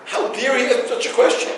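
a lightly voiced offended man; indignant quote. Rabbi mimicking an offended congregant's indignant mock outrage. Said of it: "He called somebody else and said".

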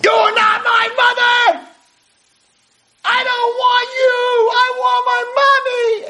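high, anguished screamed protest; screaming. Retold or imitated. Patient rejects therapists and wants her mother, screaming. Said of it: "she starts screaming".

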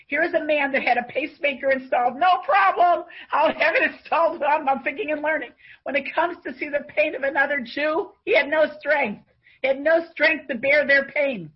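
rising fervent sermon; fervent preaching. Acted. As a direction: preach it to the congregation with fire. Preacher drives home the lesson.

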